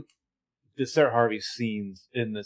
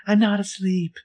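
lightly voiced Sarah, pitched higher; a hint of a breathy hospital patient; co-host imitating Sarah waking up, higher voice; 9 semitones higher than usual. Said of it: "your Sarah voice".

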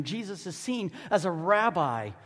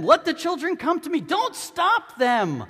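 raised, higher, forceful command; angry Jesus; 11 semitones higher, 7 dB louder than usual. A narrator's reading. Jesus rebukes his disciples for blocking children, angry. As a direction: read it as an angry order to followers. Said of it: "he was angry with his disciples".

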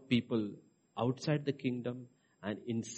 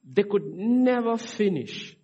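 emphatic preaching; 9 semitones higher, 5 dB louder, slower than usual. Real, not acted.

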